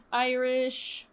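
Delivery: normal talking voice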